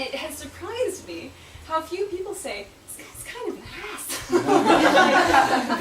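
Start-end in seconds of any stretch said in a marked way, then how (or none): 3.0-5.8 loud, slow, laughing put-down; laughing mock verdict; mock blunt verdict. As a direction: say it like an obvious blunt truth. Mock quote of readers' opinion.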